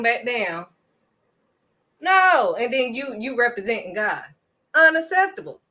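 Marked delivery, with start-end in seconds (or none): 2.0-2.6 a sharp, louder, higher no; emphatic no. Real, not acted. Preacher rebuking the congregation, sharp loud no. 4.7-5.6 louder, higher unacceptable, punched hard; emphatic condemnation. Real, not acted. Preacher condemning false prophets, loud firm unacceptable. Condemning those misrepresenting God.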